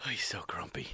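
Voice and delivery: soft whispered aside, a playful tease; teasing whisper. Real, not acted. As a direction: whisper it as if only for the audience. Teasing aside about grumpy guest.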